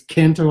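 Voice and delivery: "Canto" said CAN-toe; hard A. Shown as a demonstration. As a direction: Midwestern A in "Canto", as in "can't". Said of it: "it's a hard A".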